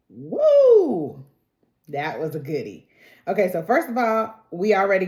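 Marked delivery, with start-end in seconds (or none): 0.1-1.2 high, loud whoop of delight; excited woo. Real, not acted. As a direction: let out a real cheer. Excited cheer after finishing show.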